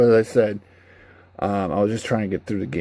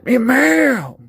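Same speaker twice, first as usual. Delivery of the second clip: high, loud dumb boast; caveman voice; 7 dB louder, slower than usual. Retold or imitated. Mocking a clumsy game character: high loud brute. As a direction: bark it like a cartoon brute.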